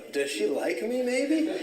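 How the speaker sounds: slow, squeaky-high wondering question; mock-bashful wonder, voice leaping way up; hopeful disbelief. Young man wondering about the girl's gift, hammed-up high disbelief.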